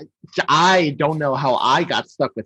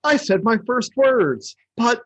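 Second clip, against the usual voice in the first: high, drawn-out snowman discovering speech; Frosty; 7 semitones higher, much slower than usual. Acted. Frosty coming to life in an existential crisis, wondering high voice. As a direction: stretch each phrase with wonder, pitched high. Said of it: "how Frosty has, like, an existential crisis".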